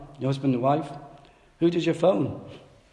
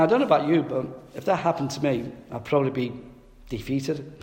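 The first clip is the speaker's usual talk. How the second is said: normal talking voice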